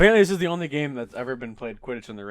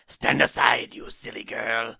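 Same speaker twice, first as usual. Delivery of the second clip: Voldemort; slower than usual. Acted. Podcast reader playing the villain at a pleading mother, commanding menace. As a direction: order her aside as a slow, menacing villain. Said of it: "that's my Voldemort voice".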